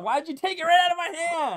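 indignant. Real, not acted. Card snatched from hand.

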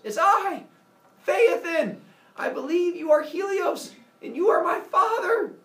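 high, loud proclamation; heroic shouted declaration. Acted. Son announcing himself to Helios, shouting high. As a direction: call it out grandly to a god. Hero shouts back to booming god.

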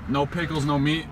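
ordinary talk

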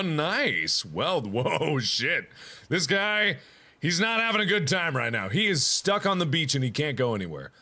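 normal talking voice

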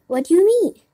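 high, loud, rising query; startled jump in pitch; surprised question. A narrator's reading. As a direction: react with astonishment. Puppet startled by the offer.

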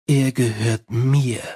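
slow, low possessive claim; possessive werewolf. Acted. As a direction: stake your claim slowly, low. Angua claims Carrot.

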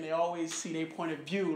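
ordinary talk, nothing marked

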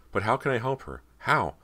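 high, urgent questioning; desperate plea. A narrator's reading. Desperate thought in narrated story.

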